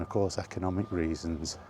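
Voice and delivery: ordinary delivery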